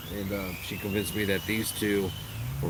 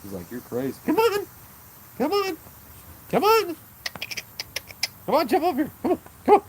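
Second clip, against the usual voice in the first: very high, louder squeaky coaxing; high coaxing call; 5 dB louder than usual. Real, not acted. Owner coaxing his dog to jump onto his lap, very high squeaky call. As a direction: coax the dog up like calling a puppy.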